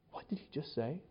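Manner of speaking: soft gasp then stunned whisper; shocked gasp. Retold or imitated. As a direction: react like scandalized readers.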